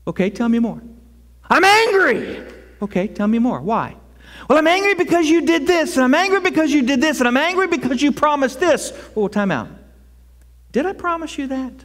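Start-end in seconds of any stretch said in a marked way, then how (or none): 1.5-2.4 high, loud mock complaint to God; mock angry complaint. A believer tells God in mock anger. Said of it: "If you're feeling angry at God". 4.5-8.9 mock angry complaint. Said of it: "If you're feeling angry at God".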